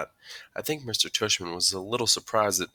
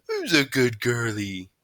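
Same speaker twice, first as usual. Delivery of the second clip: slow, raised, louder doggy-praise voice; dad voice; 4 semitones higher, 4 dB louder, slower than usual. A narrator's reading. Boy praises his dog Daisy, putting on dad voice. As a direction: coo to the dog like a dad, drawn out. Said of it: "I said in my dad voice".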